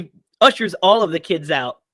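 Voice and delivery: normal talking voice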